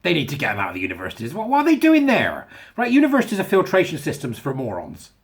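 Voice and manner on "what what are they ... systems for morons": much higher and louder indignant what; outraged disbelief. Real, not acted. Ranting about universities, in indignant disbelief.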